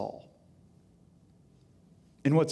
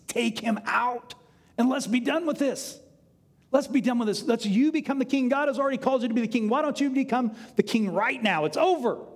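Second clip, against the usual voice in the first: insistent urging; 7 semitones higher than usual. Acted. David's men urge him on.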